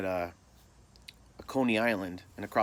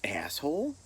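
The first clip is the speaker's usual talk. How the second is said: higher-pitched questioning echo; mock roll-call question; teasing buddy; 6 semitones higher than usual. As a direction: needle a friend with a rising question. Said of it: "my buddy starts laughing. He goes".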